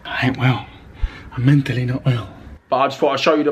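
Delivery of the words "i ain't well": normal talking voice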